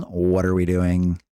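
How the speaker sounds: low, drawn-out monotone question; imitated chatter question. Streamer quoting a chatter's question back, imitated low and slow. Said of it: "Whenever you say, for example".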